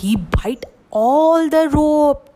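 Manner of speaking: ordinary delivery, nothing marked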